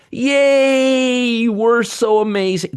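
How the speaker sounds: sarcastic high cheering; mock the Fed's smug self-praise. Sneering at the Fed, mock cheer.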